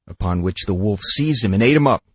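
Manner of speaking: usual speaking voice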